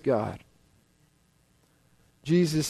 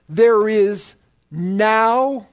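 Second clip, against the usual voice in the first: "now" stretched long and louder, very slow; stress on now; 4 dB louder, much slower than usual. Shown as a demonstration. Preacher to congregation, dwelling on the emphatic now. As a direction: slowly drive home "There is now". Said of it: "The word "now" is also a very emphatic word".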